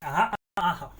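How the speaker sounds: ordinary delivery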